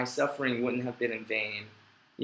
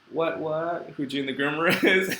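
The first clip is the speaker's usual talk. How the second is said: laughing through the joke, louder; cracking up at own joke; 3 semitones higher, 5 dB louder than usual. Real, not acted.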